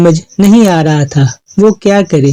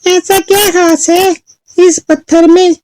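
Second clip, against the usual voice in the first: put-on very high questioning girl; curious Chulbul; 11 semitones higher than usual. Girl asks what makes stone special, high curious voice. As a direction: ask curiously in a lifted voice. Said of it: "चुलबुल ने पूछा".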